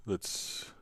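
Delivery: ordinary talk, nothing marked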